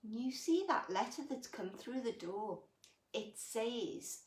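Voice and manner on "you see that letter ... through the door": usual speaking voice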